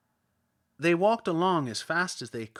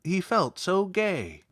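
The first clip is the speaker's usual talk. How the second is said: plain reading voice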